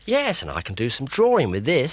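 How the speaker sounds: put-on high, loud children's greeting; cheerful kids. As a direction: call out happily as they run in.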